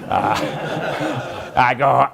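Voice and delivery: real laugh breaking through the words; chuckling through the lead-in. Real, not acted. Laughing while telling the crowd his story.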